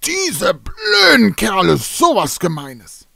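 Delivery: hammed-up outrage at the mean ravens; indignant outburst. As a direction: bang the table and cry out. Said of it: "knallte und rief".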